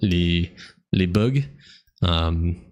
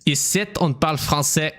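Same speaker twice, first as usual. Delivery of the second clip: put-on higher-pitched playful Québécois accent; mock Canadian French; 7 semitones higher than usual.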